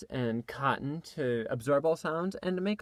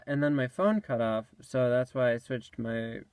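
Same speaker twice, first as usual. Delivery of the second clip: ordinary delivery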